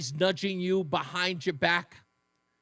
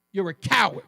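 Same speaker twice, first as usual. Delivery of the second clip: ordinary talk, nothing marked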